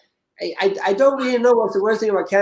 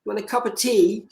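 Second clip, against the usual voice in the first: put-on British accent; 4 semitones higher, 5 dB quieter than usual. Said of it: "the most British thing you can think of in a British accent".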